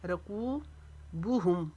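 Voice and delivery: रकूबुहुम slowly, कू held long; long कू, short बु. Shown as a demonstration. Teacher modelling madd, रकूबुहुम with long कू and short बु. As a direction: stretch only कू in रकूबुहुम. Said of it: "बू को नहीं खींचेंगे".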